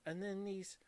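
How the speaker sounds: mock an older woman's Valley Girl speech, lightly; Valley Girl accent. Retelling a stranger who spoke to her, in a mild Valley Girl accent. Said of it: "Valley Girl".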